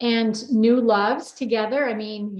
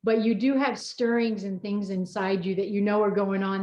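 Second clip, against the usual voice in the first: normal talking voice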